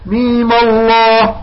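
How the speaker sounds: "ميم الله" linked, "mii" held briefly, then thick "lloh"; slow "ميم الله" with a dark long "الله"; short madd. Shown as a demonstration. Teacher repeats third reading, heavy "الله" after "ميم". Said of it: "الياء مع ميم الله حركتين".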